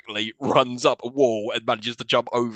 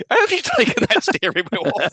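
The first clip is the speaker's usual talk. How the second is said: high, louder mock bafflement; incredulous question; 10 semitones higher, 5 dB louder than usual. Viewer baffled that the steering wheel is gone, incredulous. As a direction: ask it in amused disbelief.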